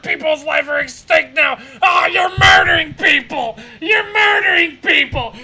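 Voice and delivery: mock the pilot's sarcasm as a shout; mock sarcastic shout. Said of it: "He's so fucking sarcastic".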